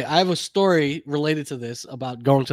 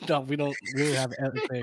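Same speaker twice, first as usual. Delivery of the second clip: ordinary delivery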